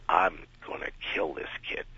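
whispered threat. Retold or imitated. Star's murderous look voiced.

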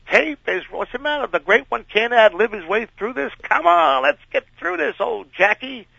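sarcastic high-pitched taunt; mock-grand taunt. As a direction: tease the great star with fake reverence. Taunting the star again.

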